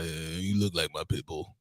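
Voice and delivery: low, slow mocking put-down; mocking dude voice. Guy mocking a woman, low and slow. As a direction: drop the voice low and slow to mock her.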